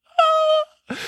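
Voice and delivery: high, melted little sigh; touched sigh. Retold or imitated. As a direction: sigh like your heart just melted. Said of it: "And I was like".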